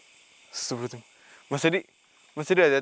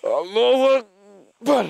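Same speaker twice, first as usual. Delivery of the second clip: very slow, higher, louder cry of Allahu Akbar; drawn-out loud invocation; 6 semitones higher, 6 dB louder, much slower than usual. Real, not acted. Ghost hunter crying out to God against the spirit, slow and loud. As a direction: stretch the cry out with full force.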